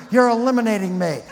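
high, loud, fast hammed-up whine; mock complaint. Preacher mocks an atheist blaming natural selection, fast hammed-up whine. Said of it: "why don't you blame the planet".

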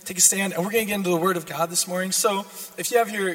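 normal talking voice

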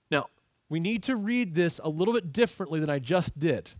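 ordinary talk, nothing marked

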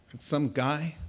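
normal talking voice

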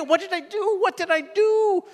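put-on high, panicky child voice; confused child. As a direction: pitch up like a lost kid asking why.

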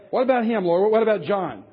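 loud, high-pitched acted complaint; mock protest. Peter complaining to the Lord about John, loud mock protest. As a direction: object loudly, as if it's unfair.

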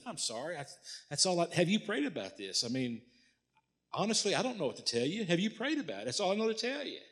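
soft, high-pitched helpless pleading; flustered apology. Retold or imitated. Pastor answering the angry church member, flustered apology.

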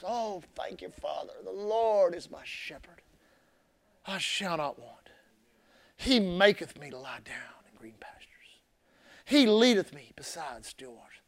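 soft, slow, almost whispered psalm prayer; quiet prayer. Shown as a demonstration. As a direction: pray it low and hushed, as if alone with God. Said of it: "I like to say it like this".

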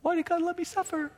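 high-pitched plaintive whine; hammed-up self-pity; mock complaint. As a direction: imitate someone bewailing their lot. Imitates sufferer's complaint.

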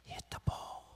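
hoarse whisper; whispered dad. Retold or imitated. Dad whispering to his son at bat, urgent. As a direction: hiss it under your breath. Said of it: "and he's like".

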